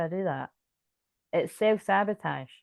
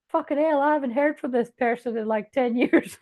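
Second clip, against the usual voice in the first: high and loud, stunned; imitating someone floored by a call; playing the receiver of a call out of the blue, higher and louder in shock; shocked exclamation; 6 semitones higher, 3 dB louder than usual. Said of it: "It's gonna be like".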